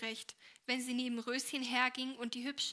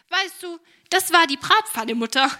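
much higher and far louder; gleeful punchline; 6 semitones higher, 19 dB louder than usual. Acted. Helene delivers the frying pan punchline to mother, gleefully. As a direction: deliver the joke with glee.

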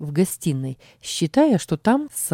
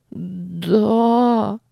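high trembling little yes; shaky voice; 5 semitones higher than usual. A narrator's reading. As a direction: quiver it out. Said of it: "дрожащим голосом произнесла она".

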